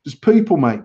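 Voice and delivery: ordinary delivery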